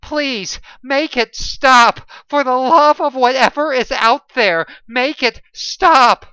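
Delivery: high, loud, slow cries of agony begging for relief; desperate, breaking plea for mercy; anguished pleading scream. Acted. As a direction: scream it like pain that won't end.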